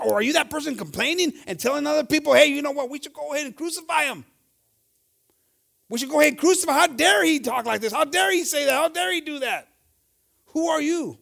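high, loud, fast impassioned preaching; fervent preaching. Acted. Preacher challenging the congregation, loud fervent preaching. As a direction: drive the question home to the church.

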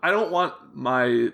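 normal talking voice

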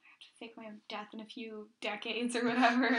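whispered, hushed inner panic; panicked whisper. Pretend. Pretending to be Keanu fretting over his cover, whispering. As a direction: whisper it like a secret worry.

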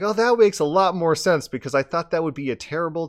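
a hint of a surprised girl asking; curious girl's voice. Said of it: "And she's like".

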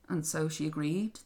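plain reading voice

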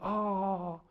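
mildly mock disappointment, questioning at the end; disappointed child. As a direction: imitate a let-down little niece. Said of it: "she was kinda disappointed".

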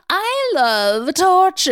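high, slow, relishing villainess; hammed-up gloating in a put-on voice; Celine. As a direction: draw the words out with theatrical delight. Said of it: "and she's like".

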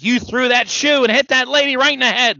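hammed-up scandalized accusation; imitated wrestling promo outrage. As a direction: imitate an indignant ringside scolding. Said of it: "And he's like".

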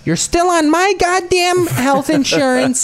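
high, slow scolding parent. Pretend. Voicing parent forbidding Olympics.

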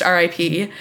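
ordinary delivery

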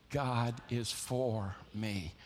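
"God is for me" very slow and soft, stretched out; slow call and response. Shown as a demonstration. Preacher leading the congregation in unison, slow and hushed. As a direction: lead the room through each word gently. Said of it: "Say it with me".

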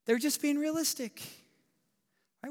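lightly voiced know-it-all skeptic; mimicking doubters.

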